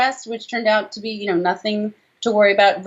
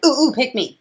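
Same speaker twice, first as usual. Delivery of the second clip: put-on eager kid; 6 semitones higher than usual. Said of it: "didn't raise my hand".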